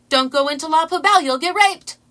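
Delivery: loud, high blunt warning; loud, high imitated protester warning; mock yell. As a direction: bellow it like a street crier. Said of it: "before you can process that".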